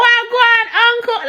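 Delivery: a hint of a Jamaican patois accent; friendly neighbor greeted in Jamaican patois; Jamaican patois greeting. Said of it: "I greeted them in patois".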